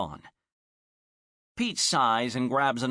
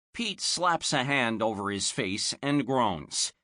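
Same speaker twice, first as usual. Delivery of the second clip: ordinary delivery, nothing marked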